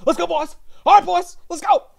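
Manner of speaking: high, loud bull-rider holler; Lane Frost. Retold or imitated. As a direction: whoop it up on the bull. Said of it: "I'm trying to do Lane Frost from Eight Seconds".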